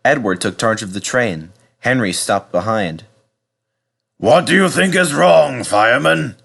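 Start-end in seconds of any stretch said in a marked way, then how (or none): 4.2-6.4 fat controller. Acted. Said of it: "asked the fat controller".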